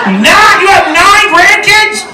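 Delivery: high, stretched-out disbelief; astonished. Pretend. Comic hears audience member has nine grandkids, high astonished yelp. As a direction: yelp it in high mock shock.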